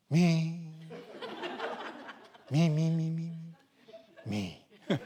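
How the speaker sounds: a cicada taking it easy; relaxed cicada. Retold or imitated. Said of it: "森本レオさんみたいにね".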